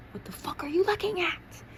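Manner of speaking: very high, strained snap at onlookers; glaring challenge. A narrator's reading. Student glaring at the hallway crowd, high strained challenge. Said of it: "you glare at the cloud and say".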